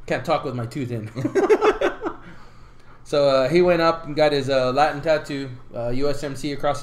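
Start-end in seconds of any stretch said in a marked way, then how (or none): 1.1-3.0 high burst of laughter; high laugh at his own joke; big laugh. Real, not acted.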